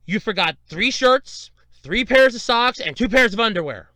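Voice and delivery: retold motherly telling-off; pissed-off mom. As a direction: imitate mom laying down each forgotten item. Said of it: "she was a little pissed".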